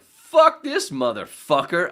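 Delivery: loud, high, drawn-out cursing; acted outrage. Retelling his anger at the parody song, outraged shout. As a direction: blow up like an offended fan. Retold fury at song trashing favorites.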